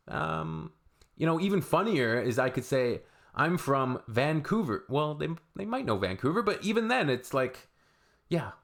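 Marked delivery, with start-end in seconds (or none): none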